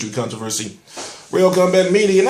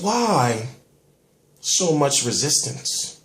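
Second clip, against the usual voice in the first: normal talking voice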